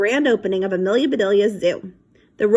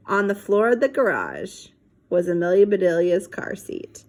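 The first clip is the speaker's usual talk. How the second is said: usual speaking voice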